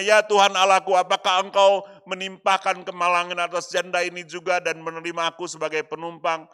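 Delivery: loud, pleading prayer cry; crying out to God. Acted. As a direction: call out to God in anguish. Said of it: "ia berseru kepada Tuhan".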